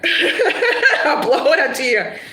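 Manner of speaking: slow, drawn out through laughter; slow laughing repeat. Shown as a demonstration. Reader relishing the phrase for the client, slow and laughing. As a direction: savour the phrase while laughing. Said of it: "We call it blow it out your ear".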